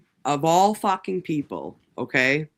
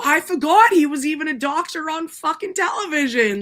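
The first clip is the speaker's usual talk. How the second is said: excited laughing quote; 12 semitones higher, 4 dB louder than usual. Retold or imitated. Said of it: "And I go".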